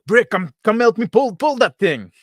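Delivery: high, loud, urgent holler; frantic high-pitched call; yelling for help. Acted. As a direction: shout for a crewmate to come and help. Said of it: "and I yell, like".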